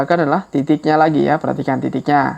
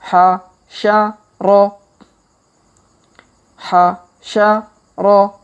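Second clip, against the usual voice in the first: slow reading; 4 semitones higher, much slower than usual. Shown as a demonstration. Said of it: "kita baca pelan-pelan".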